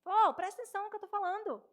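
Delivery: loud, high, fast scolding; exasperated wife's shout; shaking a husband to attention. Acted. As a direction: yell at a husband who won't listen. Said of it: "a gente chacoalha".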